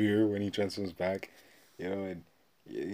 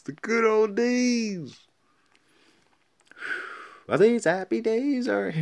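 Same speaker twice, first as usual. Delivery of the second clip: hammed-up nostalgia; 10 dB louder, slower than usual. Real, not acted. Nostalgic hammy reminiscing.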